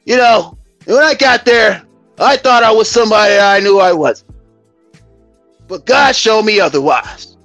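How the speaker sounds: ordinary delivery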